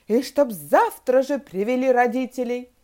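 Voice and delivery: loud, emphatic order; angry shout. A narrator's reading. Teacher demanding parents after expelling pupils, shouting angrily. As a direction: bellow a final demand. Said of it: "закричала она страшным голосом".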